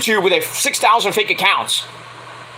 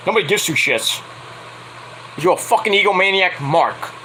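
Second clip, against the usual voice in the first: ordinary delivery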